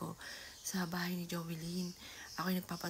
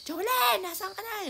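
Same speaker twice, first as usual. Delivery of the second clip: much higher, much louder yell for her name; 14 semitones higher, 10 dB louder than usual. Retold or imitated. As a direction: scream the name across the house. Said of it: "yung palaho na ako". (malakas na sigaw, hinahanap si Jovelyn)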